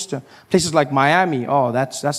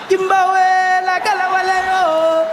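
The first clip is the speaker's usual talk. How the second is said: very high, hammed-up chanting shout; mock African tribesman calling out; 10 semitones higher, slower than usual. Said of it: "a guy in leopard skin".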